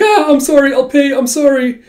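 high, loud panicky begging; frantic pleading. Acted. Caught client begs the agency for mercy, frantic squeal.

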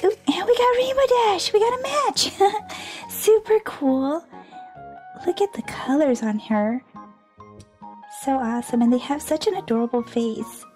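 usual speaking voice

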